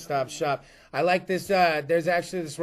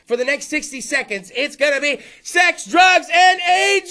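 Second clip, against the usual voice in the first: building, much higher and louder show-title announcement; announcer shout; 8 semitones higher, 9 dB louder than usual. Acted. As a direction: proclaim the new show name like a ring announcer. Announcing the show's new name.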